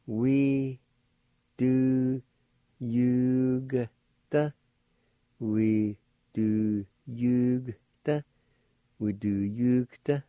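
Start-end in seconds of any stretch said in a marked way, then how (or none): 0.1-4.5 We, do, you, g broken apart, the G sounded lightly; syllable by syllable. Shown as a demonstration. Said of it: "pronounce the G sound ... like this". 5.4-8.2 We do yugta drawn out very slowly; slow demonstration. Shown as a demonstration. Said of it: "like this".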